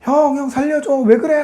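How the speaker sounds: high, pleading cry; frightened plea. Acted. Joseph in the pit begging his brothers, scared high plea. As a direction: plead for help in acted fear. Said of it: "너무 무서운 거예요".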